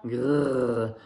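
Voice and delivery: growled playful grr; mock roar. Alistair growls to scare baby koala, with a mock roar. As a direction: growl like a pretend lion. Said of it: "rugit Alistair".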